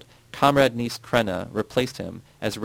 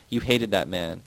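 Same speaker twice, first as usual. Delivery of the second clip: ordinary delivery, nothing marked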